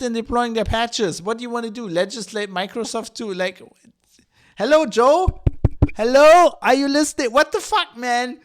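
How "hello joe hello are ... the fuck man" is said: loud, high hail, as if nobody is listening; exasperated calling out. Pretend. Speaker hailing Joe who isn't listening, exasperated calling out. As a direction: call out louder and higher, incredulous. Calls out to someone not listening.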